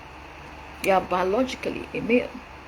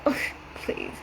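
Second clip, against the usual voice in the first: scoffing whisper; 7 dB quieter than usual. Retold or imitated. Mimicking a bored spouse.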